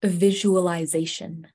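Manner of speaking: slow, smooth, relaxing radio voice; vocal fry. Real, not acted. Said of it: "Why did your voice sound so good?".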